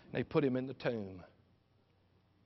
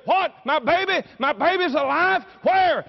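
high, loud incredulous questions; astonished joy; 14 semitones higher, 11 dB louder than usual. Acted. Mary learns her baby is alive, astonished shout. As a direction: shout it in amazed disbelief.

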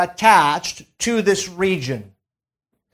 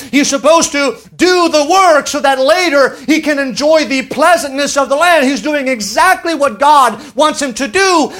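raised, emphatic preaching voice; preaching at full force; 5 semitones higher than usual. Acted. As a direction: proclaim it like a preacher.

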